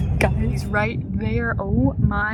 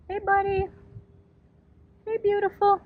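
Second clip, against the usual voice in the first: pet talk; 11 semitones higher, 4 dB quieter than usual. Real, not acted. Greeting a bird from the kayak, high soft pet talk. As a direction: coo to the animal, high and gentle.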